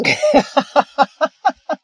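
high breathy laugh at his own joke; self-mocking laugh. Real, not acted. Laughing at calling himself a selfish stuck-up citizen, amused. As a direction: laugh it off at yourself.

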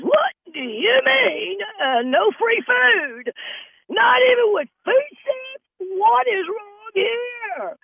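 very high, slow, drawn-out outraged shriek; indignant high-pitched hyena scream. Retold or imitated. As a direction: wail at the front desk like a hyena. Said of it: "She starts screaming like a, a hyena".

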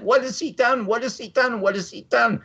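mild mock yell at the television set; retold screaming. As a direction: cry it out at the TV in disbelief. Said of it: "I start screaming at the television".

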